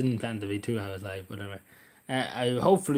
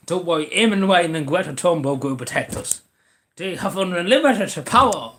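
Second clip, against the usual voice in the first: sarcastic reassurance; 5 semitones higher, 6 dB louder than usual. Acted. Mock reassurance about politicians.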